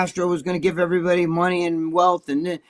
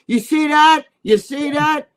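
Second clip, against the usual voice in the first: mock excited fans; 8 semitones higher, 5 dB louder, slower than usual. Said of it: "they'd be like".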